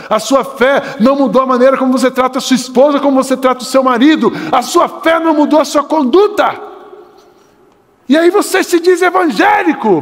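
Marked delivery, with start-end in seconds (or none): 4.5-10.0 fiery preacher's shout; preaching shout. Real, not acted. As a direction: cry it out from the pulpit to shake them. Preacher rebuking the congregation.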